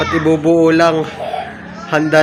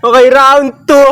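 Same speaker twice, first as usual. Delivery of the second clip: shouting; 7 semitones higher, 6 dB louder than usual. Real, not acted. Calling out next round.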